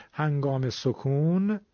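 سکون said slowly with the stretched Hejazi zad; drawn-out zad. Shown as a demonstration. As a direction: hold the final sound of سکون long, like a dove's coo. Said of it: "صدای کش اومدنش".

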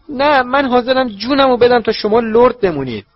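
high, loud desperate plea; desperate vow. A narrator's reading. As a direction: swear it in panic. Servant swears loyalty.

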